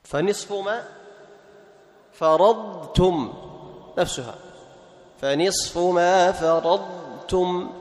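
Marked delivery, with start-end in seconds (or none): 2.1-3.3 very slow فرضتم, ض held; drawn-out, unmerged فرضتم; clear Dad before Ta. Shown as a demonstration. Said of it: "تظهر الضاد عند التاء". 5.2-7.7 slow recitation, ض held in فرضتم; measured verse with unmerged فرضتم; clear Dad before Ta. Shown as a demonstration. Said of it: "تظهر الضاد عند التاء".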